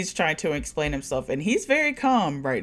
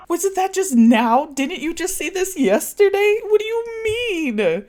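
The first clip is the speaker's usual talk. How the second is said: mock Sophie; 4 dB louder than usual. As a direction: play dumb in a high voice. Voicing Sophie confused.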